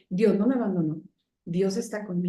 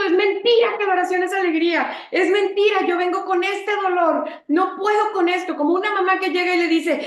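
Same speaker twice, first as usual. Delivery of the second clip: raised, much higher, almost shouting; anguished outcry; 6 semitones higher, 5 dB louder than usual. Real, not acted. Preacher insisting prayer is not joy, loud and anguished. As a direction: pour out the pain at full voice.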